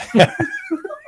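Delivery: very high, wild laugh at his own punchline; high cackle. Real, not acted. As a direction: burst into a squealing laugh. Teller laughs at own joke.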